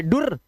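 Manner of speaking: डुर misread with a short u; डुर with a brief vowel, as a wrong guess. Shown as a demonstration. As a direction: say डुर as a quick "dur". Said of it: "ये दोनों ही नहीं है".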